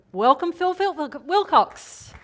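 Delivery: high, loud announcer welcome; excited welcome. Real, not acted. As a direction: hype up the guest's entrance. Host announces guest.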